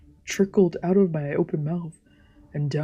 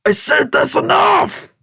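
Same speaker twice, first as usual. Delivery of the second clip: full shout, much higher and louder; raised voice; 11 semitones higher, 8 dB louder than usual. Acted. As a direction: roar it to stop the mob. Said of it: "He raised his voice".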